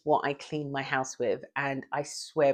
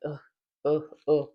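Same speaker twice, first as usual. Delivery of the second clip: tired groans. Retold or imitated. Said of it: "I'm just like".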